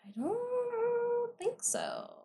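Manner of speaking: very high, soft, drawn-out hedge; long squeaky musing; doubtful squeak. Real, not acted. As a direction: stretch it high and quiet, unsure. Unsure answer about killer's name.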